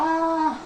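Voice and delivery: short high cry of fright; retold scream. Girl screaming at the jinn, short frightened cry. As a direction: yelp it out in sudden fear. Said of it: "فضلت تصوت وتصرخ".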